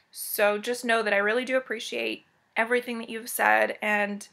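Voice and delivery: normal talking voice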